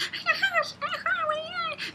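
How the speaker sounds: very high bird chatter; comic falsetto bird cries; imitated finch squawk. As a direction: squeal like a flustered mother finch. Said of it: "she's like".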